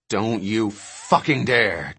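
slow, low threat; menacing warning. Acted. Dodgson forbids King to get rid of the egg, slow and menacing. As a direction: warn him off slowly and in a low pitch.

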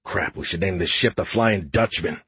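usual speaking voice

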